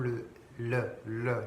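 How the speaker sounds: "le" repeated with the tongue pressed up; L leading to R. Shown as a demonstration. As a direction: close the L as tightly as possible. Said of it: "de faire des L". (« le » avec la langue, fermé au maximum)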